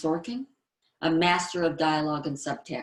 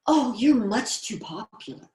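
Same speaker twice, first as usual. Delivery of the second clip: higher, fawning flattery; complimentary; 5 semitones higher, 4 dB louder than usual. Acted. Complimentary girl letting a boy down with flattery. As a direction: gush the praise. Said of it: "a complimentary trait".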